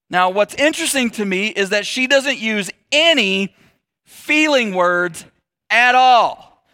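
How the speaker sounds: ordinary talk, nothing marked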